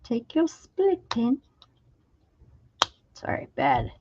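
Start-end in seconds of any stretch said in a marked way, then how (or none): 0.0-1.4 high, slow attempt at someone else's accent; badly faked accent, pitched high and drawn out; bad Tracy accent. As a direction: put on a poor imitation accent, slow and high. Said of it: "do her accent".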